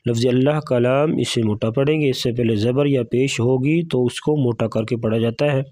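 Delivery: ordinary talk, nothing marked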